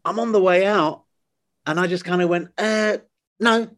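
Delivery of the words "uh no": hesitant, high-pitched casual answer; retold offhand no. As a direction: imitate an unthinking little no. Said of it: "not thinking really seriously about it".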